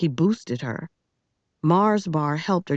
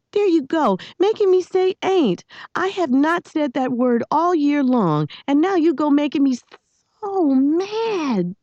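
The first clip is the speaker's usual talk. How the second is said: high-pitched exasperated rant; indignant scolding; 10 semitones higher than usual. A narrator's reading. Girl blaming boy for making her say ain't, high and indignant. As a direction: blame him, voice pitched way up.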